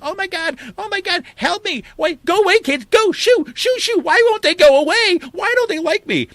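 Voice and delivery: very high, frantic comic wail; Nancy Pelosi. Retold or imitated. Comedian's skit of politician fleeing activists, high mock panic. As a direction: shoo them away in a hammed-up squeal. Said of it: "Nancy Pelosi comes out".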